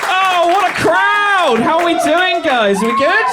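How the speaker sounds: loud, high hype greeting; big excited crowd warm-up; calling out to crowd. Real, not acted. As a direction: work the room from the top.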